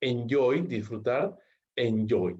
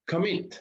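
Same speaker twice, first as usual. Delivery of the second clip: "Commit" raised in pitch, co-MIT; stress on last syllable; 7 semitones higher than usual. Shown as a demonstration. Teacher giving another stress example, "Commit" stressed on MIT. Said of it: "la mayor fuerza de voz está sobre la última".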